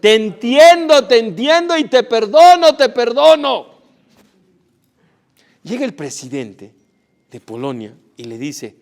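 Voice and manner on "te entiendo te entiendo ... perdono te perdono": loud, higher, slow shout of forgiveness. Acted. Old rabbi shouting after the thief, loud and fervent. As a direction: call after the fleeing thief with fervent mercy. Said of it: "le grita y dice".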